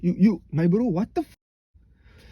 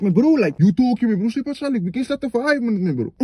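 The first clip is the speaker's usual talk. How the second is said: arguing; 4 semitones higher than usual. Retold or imitated. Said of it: "you arguing with a bro".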